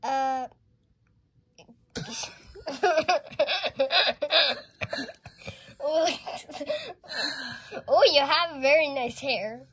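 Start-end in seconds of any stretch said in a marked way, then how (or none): 1.9-7.8 giggling laugh. Pretend. Pretend dentist scene, laughter.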